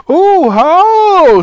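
long, loud high howl; drawn-out high "oh" of distress; shouted wail. A narrator's reading. Saucepan man in trouble above, shouting. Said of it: "shouted the saucepan man above them".

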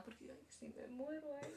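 fearful whisper. Retold or imitated. Recalling her fear of dying.

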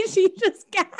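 giggling at her; laughing. Real, not acted. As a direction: laugh through the words.

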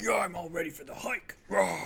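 hint of a pumped-up jock; mock hype ending in a loud roar; mock athletic bro. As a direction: tease with gym-bro hype. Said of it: "She's like".

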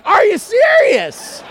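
very high, slow, loud yell; exasperated shout. Retold or imitated. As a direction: yell it drawn out in comic disbelief. Retold exasperation at his no.

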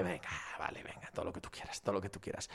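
very soft, almost whispered giving in; a low, breathy mumble of surrender; mock resigned murmur. Muttering to himself as he gives in to the owners' rhetoric, very soft.

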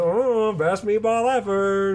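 high, slow, overdone thanks; mock grateful character. Imitating Robbie thanking the old lady, slow mock gush. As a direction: gush it out slowly in a put-on voice.

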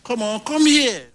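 high, urgent summons; calling out. Real, not acted. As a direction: call someone over across the room. Preacher calls someone over.

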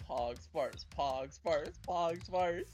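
ordinary talk

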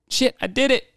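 high-pitched, giddy mock celebration; imitating excitement. Imitating a soldier who made his kill, all excited. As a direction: squeal it like you can't believe it. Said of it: "he was, like, all excited".

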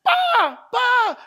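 put-on high, loud frantic child's cry; child crying for help. Child trapped in the burning house calling papa, frantic cry. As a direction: scream for papa from inside the fire. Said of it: "bilang nagsigaw".

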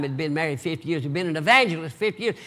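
ordinary talk, nothing marked

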